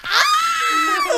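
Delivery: very high shriek; high delighted shriek; excited scream. Retold or imitated. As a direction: squeal at him in delight. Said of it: "kept being like".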